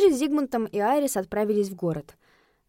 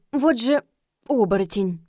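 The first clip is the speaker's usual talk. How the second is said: ordinary delivery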